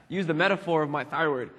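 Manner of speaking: ordinary delivery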